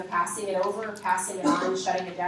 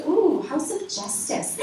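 slow, higher, drawn-out ooh of awe; impressed ooh; 7 semitones higher, slower than usual. Retold or imitated. Mom reacting to the building's name, impressed and slow. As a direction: savour the name like it means something. She goes.

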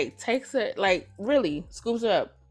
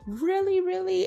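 very high, incredulous questioning; squeaked-up retold double take; mock disbelief; 12 semitones higher than usual. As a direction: imitate a woman taken aback. Acts woman's reaction to threat.

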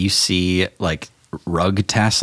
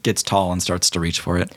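ordinary talk

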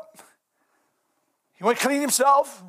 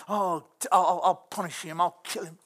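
lightly mocking, boastful David; a touch of smug bragging; boastful king; 6 semitones lower, 4 dB quieter than usual. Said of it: "he's boastful".

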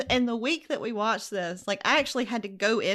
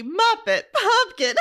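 Muppet and pumpkin said higher through laughter; laughing endearments; 8 semitones higher, 4 dB louder than usual. Shown as a demonstration. Listing Lorne's pet names for Angel, laughing. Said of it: "Lorne has got angel".

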